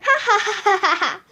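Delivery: quick, high hammed-up ha-ha laugh; fake jolly laugh. Principal laughing at his own pun, quick hammed-up ha-ha. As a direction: laugh at your own bad joke.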